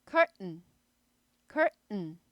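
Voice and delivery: curtain with a curled r then a stopped t and n; American curtain. Shown as a demonstration. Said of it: "여기 이제 스타카토 있죠".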